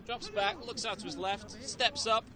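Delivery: quick, lifted commentary call; play-by-play. Acted. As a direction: call the action live.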